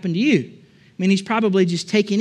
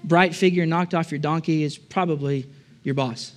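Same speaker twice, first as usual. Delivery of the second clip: normal talking voice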